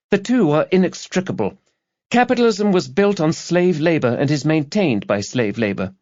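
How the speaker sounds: put-on high young woman's voice, preaching her politics; earnest young activist. A narrator's reading. Student activist lecturing the detective, high zealous voice. Girl argues back.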